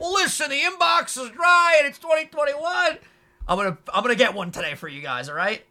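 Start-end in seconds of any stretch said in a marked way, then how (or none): 0.0-3.0 mock announcer shout. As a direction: shout it like a tired old catchphrase. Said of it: "we're not gonna do the old".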